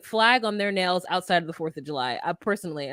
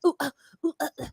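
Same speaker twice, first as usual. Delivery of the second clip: mock yoga grunts; 5 semitones higher, much faster than usual. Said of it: "just like".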